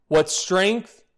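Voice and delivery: normal talking voice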